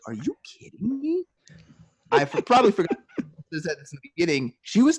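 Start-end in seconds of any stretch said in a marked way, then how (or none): 0.1-1.2 high-pitched imitation of customer disbelief; mock incredulous customer. Customer learning how banks make money, mock disbelief. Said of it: "she was like".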